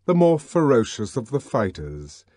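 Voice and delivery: ordinary delivery, nothing marked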